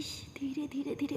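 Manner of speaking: soft, hushed shushing; चुप कराना. A narrator's reading. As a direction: hush her so nobody wakes. Shushing so mom won't wake. (धीमी आवाज़ में चुप कराते हुए)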